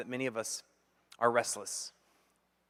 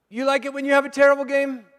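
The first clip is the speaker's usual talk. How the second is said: higher, louder and fast; mock challenge; 11 semitones higher, 10 dB louder, faster than usual. Father grilling his son about losing, loud and fast. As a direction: push the question hard at the son. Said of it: "And I said".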